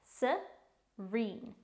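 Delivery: "serene" slowly, split as se, reen; slow teaching demo of "serene"; syllable by syllable. Shown as a demonstration. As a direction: sound out "serene" with a gap after se. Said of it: "It's pronounced".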